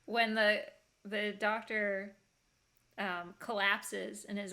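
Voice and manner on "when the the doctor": ordinary delivery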